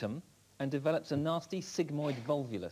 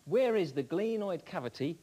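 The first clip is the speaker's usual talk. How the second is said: normal talking voice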